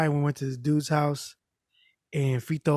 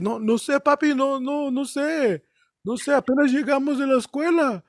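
pleading; 9 semitones higher than usual. Retold or imitated. Friend pleading with angry dad.

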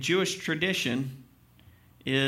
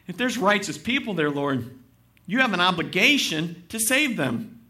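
lightly voiced Abraham, raised and pleading; a hint of Abraham begging the Lord; Abraham's plea; 3 semitones higher, 5 dB louder than usual. Voicing Abraham crying out.

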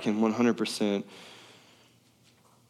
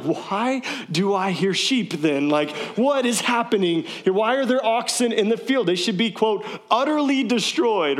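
indignant Samuel; 8 semitones higher than usual. Retold or imitated. Samuel confronting Saul about the sheep, incredulous. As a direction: demand answers like a shocked prophet.

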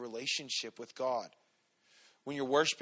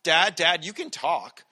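put-on higher and louder, insistent kid's nagging; teasing little daughter; 6 semitones higher, 8 dB louder than usual. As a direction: needle Dad like a cheeky kid. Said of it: "She's like".

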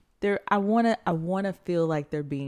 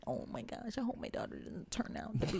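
hushed worried whisper; 7 semitones higher, 14 dB quieter than usual. Retold or imitated. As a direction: whisper it like a fretful dad. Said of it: "and then you were like".

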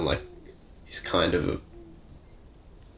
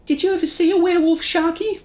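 put-on young kid voice; 5 dB louder than usual. Young kid's voice.

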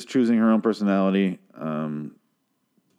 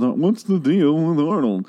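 higher, slow, drawn-out comic question; stand-up comic delivery; 7 semitones higher, 3 dB louder, slower than usual. Shown as a demonstration. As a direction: pitch it like a stand-up bit.

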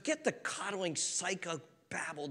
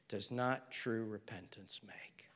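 hushed whisper; 8 semitones lower, 12 dB quieter, slower than usual. Acted. Solemn closing maxim.

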